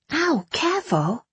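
sharp high ow of pain; pained wince. Acted. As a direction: wince through the sting. Bandaging injured hand.

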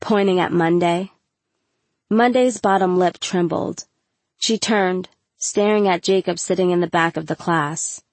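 ordinary delivery

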